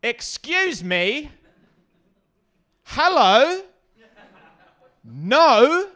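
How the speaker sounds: high, loud, clipped commanding mum; stern mum order. Retold or imitated. A mum on the platform halting a scooter kid, high loud command. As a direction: stop him dead like a fierce mum. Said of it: "just stepped out ... and just went".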